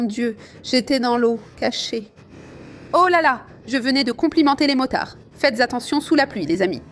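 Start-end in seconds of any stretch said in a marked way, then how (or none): 2.9-3.5 loud, high oh là là after the jolt; real alarm, louder and higher; startled exclamation. Real, not acted. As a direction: blurt it out as the bike drops. Hits a hidden pothole.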